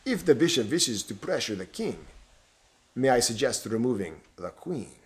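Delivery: lightly voiced Romanian villain; Romanian accent. Stranger suggesting the princess's removal, Romanian accent. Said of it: "spoke again in his foreign accent".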